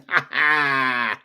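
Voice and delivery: drawn-out gleeful cackle; triumphant laugh. Real, not acted. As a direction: laugh out your victory. Triumph after naming Cubone.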